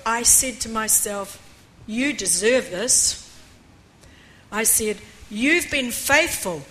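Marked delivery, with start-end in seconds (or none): none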